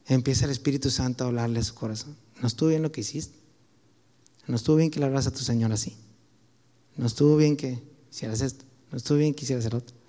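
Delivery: gently convicting, hushed; quiet inner voice. Retold or imitated. Espíritu habla quedo al corazón.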